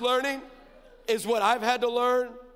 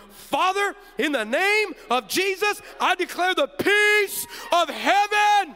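high, loud, drawn-out declaration; imitating a full-force shouted prayer; forceful prayer; 10 semitones higher, 7 dB louder, slower than usual. Dad imitating daughter praying for viewers on air, forceful declaration. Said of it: "Why don't you pray for 'em".

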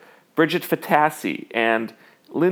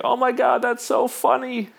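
much higher, mock-delighted squeal; gushing audience member; 11 semitones higher than usual. Crowd gushes at the comedian's joke, in a high mock-delighted voice. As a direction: play the thrilled crowd reacting to the joke. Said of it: "everyone's like".

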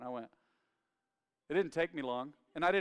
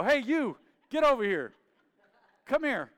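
put-on loud, higher dad calling a bored kid; imitating dad's yell; 7 semitones higher, 8 dB louder than usual. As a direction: summon the kid across the room. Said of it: "he'd go".

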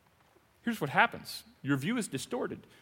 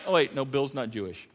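ordinary talk, nothing marked

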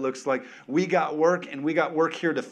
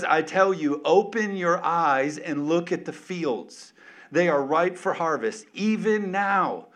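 full-force preaching; 3 semitones higher, 6 dB louder than usual. Acted. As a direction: proclaim it like a call to the fields. Preaching Jesus' words at full force.

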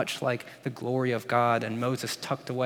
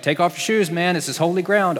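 louder, higher, bossy commanding bush; burning bush voice; 5 semitones higher, 7 dB louder than usual. Retold or imitated. The burning bush ordering Moses, loud mock command. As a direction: boom out the order like a cocky voice from the fire. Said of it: "the burning bush is like".

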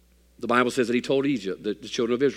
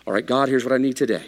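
ordinary delivery

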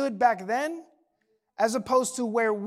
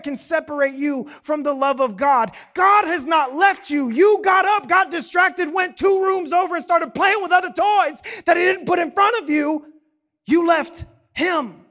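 loud, high preacher's shout; impassioned preaching; 5 semitones higher, 7 dB louder than usual. Real, not acted. Pastor confronts church on who left God, impassioned preaching. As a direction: drive it home at full force.

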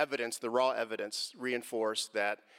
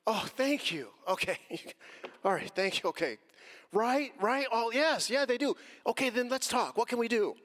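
pretend grateful reply; 9 semitones higher than usual. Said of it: "it would have been".

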